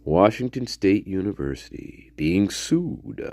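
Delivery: hushed reading of the news title; whispered headline. Real, not acted. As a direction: whisper the headline as you read it.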